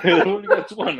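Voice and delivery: high laughing line; laughing quote. Retold or imitated.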